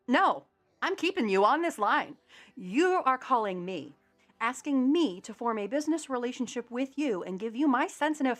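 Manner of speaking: emphatic indignation. Retold or imitated. Customer keeping scam caller on the line, indignant.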